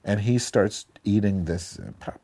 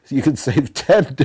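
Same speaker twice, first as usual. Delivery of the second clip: laughing through it; 5 semitones higher, 5 dB louder than usual. Real, not acted. Laughing while telling the sale story.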